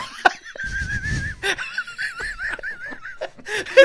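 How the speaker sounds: real helpless laughing fit; big laughing fit. Real, not acted. Hosts cracking up over scat.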